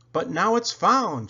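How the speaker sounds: high, loud joyful cheer; a happy engine's loud, high cheer. A narrator's reading. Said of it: "cheered Thomas".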